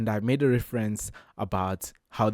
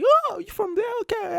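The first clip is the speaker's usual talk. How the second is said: much higher mock voice of an eager local; imitating a delighted local; 6 dB louder than usual. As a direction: play the welcoming local, high and gushing. Mimicking South African host.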